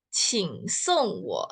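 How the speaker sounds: "Qing song wo" drawn out slowly; slow Mandarin. Shown as a demonstration. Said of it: "itu cara bacanya". (pelafalan Mandarin pelan)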